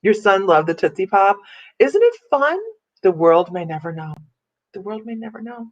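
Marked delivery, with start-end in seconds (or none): 4.7-5.7 hushed advert quote. Retold or imitated. Woman recalling the Tootsie Pop advert, in a hushed echo. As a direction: say it small and quiet, like a tagline. Repeats the advert line far softer.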